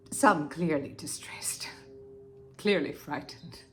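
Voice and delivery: hushed horror. Acted. Describing horrifying photographs.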